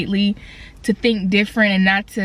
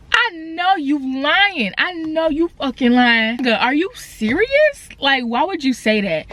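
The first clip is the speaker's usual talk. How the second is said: higher-pitched, exaggerated incredulous reaction; retold incredulity; 4 semitones higher than usual. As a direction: stretch it out as if you can't believe your ears. Retold disbelief at dad's response.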